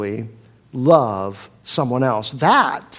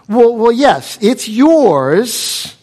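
exasperated parent; 7 semitones higher, 7 dB louder, much slower than usual. Retold or imitated. Parent gives in to child.

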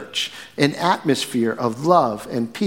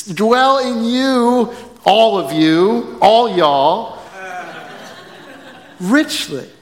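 slow, drawn out, breaking into laughter on "all y'all"; laughing through it; 6 semitones higher, slower than usual. Real, not acted. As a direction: stumble through the plurals, giggling. Joking plural forms, laughing.

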